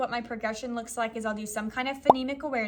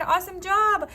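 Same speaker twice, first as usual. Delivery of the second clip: excited praise; 8 semitones higher, 6 dB louder than usual. Retold or imitated. Teacher praising kids.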